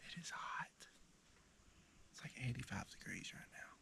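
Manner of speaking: soft unvoiced whisper. Real, not acted. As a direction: keep it to a hushed whisper. Hunter whispering in the heat.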